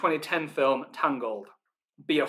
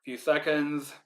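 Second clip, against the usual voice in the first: ordinary talk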